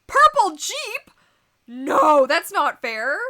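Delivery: loud high shocked cry; surprised outcry. Real, not acted. Streamer reacting to viewer Eric's gift with a loud shocked cry. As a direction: shriek in disbelief.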